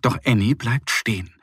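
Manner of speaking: usual speaking voice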